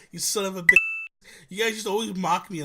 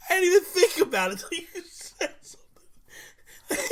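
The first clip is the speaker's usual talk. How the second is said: words squeezed out through laughter; can barely speak for laughing; laughing while talking; 11 semitones higher, 4 dB louder, slower than usual. Real, not acted. Laughing fit.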